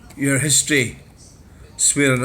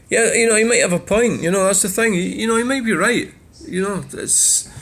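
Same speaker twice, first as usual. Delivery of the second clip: mock agreement; 7 semitones higher than usual.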